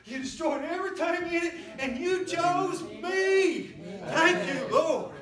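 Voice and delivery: loud, high joyful shout of praise; Noah praising. Retold or imitated. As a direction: shout thanks to the Lord, overjoyed. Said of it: "He'd say".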